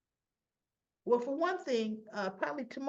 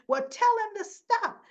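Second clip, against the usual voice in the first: much higher, louder yelling; mock shout; 12 semitones higher, 7 dB louder than usual. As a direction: yell it at him in a panic. [name] yelling at Jason.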